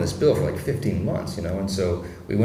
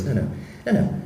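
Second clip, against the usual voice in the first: lightly voiced Trump, dismissive and sure; a hint of President Trump brushing off clever titles; Trump impression; 3 semitones lower, 3 dB quieter than usual. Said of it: "a great impression of President Trump".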